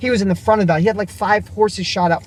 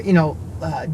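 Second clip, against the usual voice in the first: ordinary delivery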